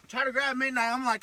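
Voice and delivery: normal talking voice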